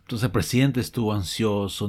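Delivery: normal talking voice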